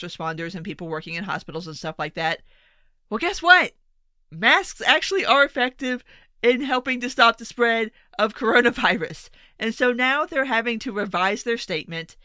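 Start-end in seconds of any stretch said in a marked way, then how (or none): 3.1-3.7 loud high sarcastic jab; sarcastic told-you-so. Real, not acted. Sarcastic reveal after CDC. 4.3-9.3 louder, actually stressed; pointed correction; emphatic correction. Real, not acted. Correcting CDC statement.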